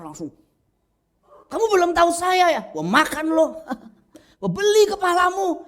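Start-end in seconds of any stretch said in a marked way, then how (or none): 1.5-5.6 loud, high-pitched boasting threat; mock an arrogant man flying off the handle; arrogant outburst. Preacher acting out a man with wounded pride, arrogant high shouting. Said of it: "Eh, sombongnya itu orang".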